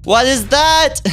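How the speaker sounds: loud, high-pitched cry of alarm; startled yell. Real, not acted. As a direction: yell it out in sudden fright. Jumpscare in horror game.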